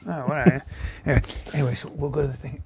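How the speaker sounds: low, soft imitated chatter; hushed dismissal. Guy ignoring the uncle and chatting on, hushed dismissal. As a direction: brush him off quietly and carry on. Guy resumes talking during singing.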